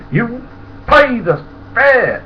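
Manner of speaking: high, slow conductor shout; angry demand. Retold or imitated. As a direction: bark it at the drunk. Conductor yelling at drunk.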